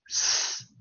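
S as a long unvoiced hiss, sss; voiceless S sound. Shown as a demonstration. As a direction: demonstrate S as a pure sustained s sound. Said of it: "清音". (S 发成拉长的清音 sss)

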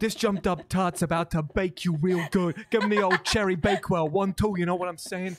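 high, loud put-on brag; hammed-up sassy tough talk. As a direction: strut it like a cocky baker. Mock boast, acting tough.

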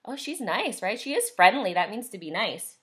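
plain reading voice

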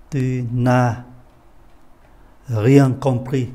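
"Tu n'as rien compris" spoken slowly, each word separated, with a long pause after "n'as"; slow careful repeat. Shown as a demonstration. Teacher repeats slowly for the class, word by word. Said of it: "Tôi xin lỗi, đây có chữ S".

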